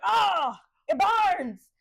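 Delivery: loud, high cry of burning; hammed-up monster yell of pain; mock pained cry. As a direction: cry out as if the light scorches you. Monster reacts to light in retelling.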